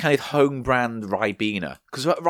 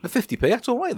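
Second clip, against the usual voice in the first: high-pitched approval; 10 semitones higher, faster than usual. Real, not acted. Pleased at the low price.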